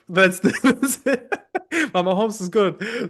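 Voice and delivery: chuckling delight; laughing through the praise; light real laughter while speaking. Real, not acted. Delighted by Mama Holmes.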